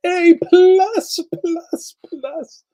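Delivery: squealed high, rising A plus; excited high praise. Real, not acted. To chat, grading the movie, squealing with delight. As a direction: gush over it like a fan.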